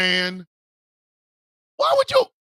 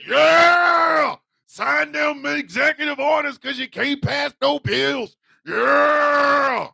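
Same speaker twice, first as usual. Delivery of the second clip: cheering supporter; 4 semitones higher, much slower than usual. Acted. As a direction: whoop it up like a rally fan. Trump supporter cheering at stadium.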